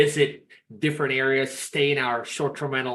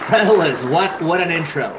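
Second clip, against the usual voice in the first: excited; 4 semitones higher, 8 dB louder than usual. Real, not acted. Guest reacts to big intro.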